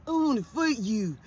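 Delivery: drawn-out, reluctant mock threat; timid challenge. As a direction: imitate a meek kid picking a fight. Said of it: "Man, say it fucking louder".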